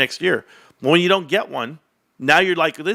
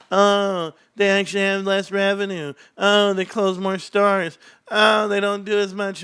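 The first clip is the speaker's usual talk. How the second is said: higher, sing-song mock complaint; mimicking bearish critics, slow mocking "Oh, they close more stores"; mocking the naysayers; 6 semitones higher, slower than usual. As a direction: parrot the doom-sayers with a sneer.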